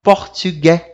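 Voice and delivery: "Portugais" ending on open è; French "Portugais" with pouted u; silent final S. Shown as a demonstration. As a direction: drop the S of "Portugais". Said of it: "A gente não fala essas letrinhas".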